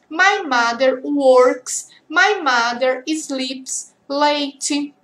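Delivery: each "My mother" sentence drawn out word by word; very slow example list. Shown as a demonstration. Teacher reading example sentences about a mother very slowly. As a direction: read the list slowly for students. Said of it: "você fala várias coisas".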